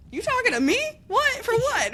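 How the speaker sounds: high, startled protest; a student wrongly accused, protesting in disbelief; indignant disbelief. Retold or imitated. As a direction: pitch jumps up in shocked protest. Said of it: "And I was like".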